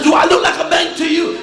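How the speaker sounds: loud, high furious yell; angry yelling. Retold or imitated. Traveller yelling at the uniformed officer, loud and high. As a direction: tear into the officer. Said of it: "I was yelling at the guy".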